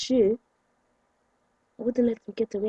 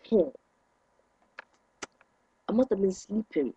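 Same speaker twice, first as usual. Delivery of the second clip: normal talking voice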